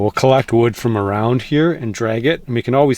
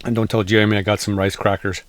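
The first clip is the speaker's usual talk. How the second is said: ordinary talk, nothing marked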